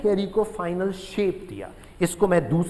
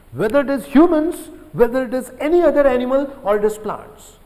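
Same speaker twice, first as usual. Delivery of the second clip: slow and louder, every word spaced out; slow emphatic stress; 4 semitones higher, 5 dB louder, much slower than usual. Acted. Teacher to students, hammering home the key point, slow and louder. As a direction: lean hard on each word so students remember.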